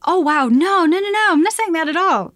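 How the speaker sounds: hasty reassurance. Acted. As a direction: rush to clear up the friend's misunderstanding. Acted hasty denial to friend.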